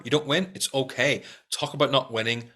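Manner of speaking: ordinary talk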